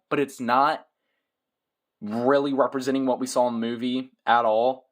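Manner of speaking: ordinary talk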